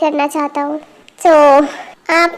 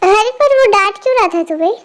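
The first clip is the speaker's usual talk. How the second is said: normal talking voice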